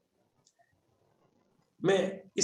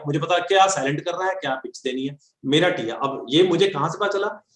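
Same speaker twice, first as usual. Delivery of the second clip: usual speaking voice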